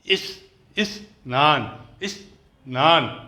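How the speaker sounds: slow 'is', a gap, then 'naan'; split into sounds. Shown as a demonstration. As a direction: sound out इस and नान as separate pieces. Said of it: "आवाज़ कैसे निकलेगी?".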